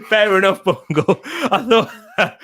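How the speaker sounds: talking through real laughter; giggling, pitch jumping up; laughing. Real, not acted. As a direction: laugh your way through conceding the point. Laughs at his own mistake.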